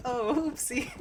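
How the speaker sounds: a sheepish giggly oops; embarrassed oopsie. Retold or imitated. To the driver, caught mirroring her Texas accent, laughing oops.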